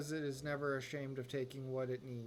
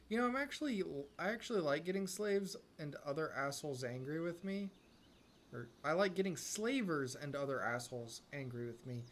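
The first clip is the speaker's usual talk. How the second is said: ordinary delivery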